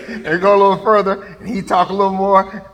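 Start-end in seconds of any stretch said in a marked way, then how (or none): none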